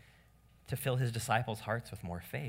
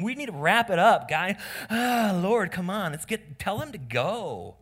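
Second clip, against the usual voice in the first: whiny, louder, higher complaint; grumbling followers, loud and pouty; pouting disciples; 7 semitones higher, 8 dB louder than usual. Acted. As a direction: sulk and whine at Jesus to wrap it up. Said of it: "these guys are just pouting here".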